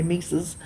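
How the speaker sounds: "mices" as MY-siz, quoting mom's made-up plural; mom's word mices. Retold or imitated. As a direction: echo mom saying "mices", MY-siz. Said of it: "That's what my mom used to say".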